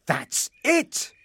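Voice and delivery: high burst of inspiration; excited cry. A narrator's reading. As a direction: exclaim as the idea strikes. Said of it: "cried Frederick".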